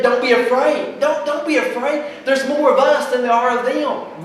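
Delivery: loud, high, urgent preaching; emphatic quoted reassurance. Acted. Elisha telling his servant not to fear, loudly emphatic.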